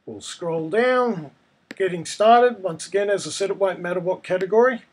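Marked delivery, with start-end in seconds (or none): none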